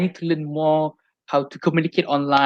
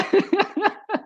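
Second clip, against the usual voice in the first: squealing laugh, pitched way up; high burst of laughter; 3 dB quieter than usual. Real, not acted. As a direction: break into a high giggle. Reacts to Google Drive joke.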